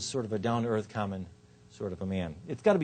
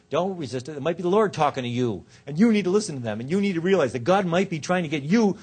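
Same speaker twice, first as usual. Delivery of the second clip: louder, higher, insistent sermonizing; preaching at listeners; 5 semitones higher, 7 dB louder than usual. Retold or imitated. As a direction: press every "you" on the audience, imitating a preacher. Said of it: "kept talking to them about you".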